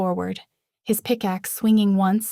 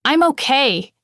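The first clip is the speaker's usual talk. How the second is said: seething; 6 semitones higher, 7 dB louder than usual. A narrator's reading. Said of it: "he seethed".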